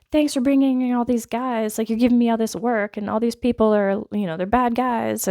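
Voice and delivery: sarcastic thanks from an overworked doctor; annoyed doctor. Retold or imitated. Doctor grumbling to the hero about extra work, mock annoyed.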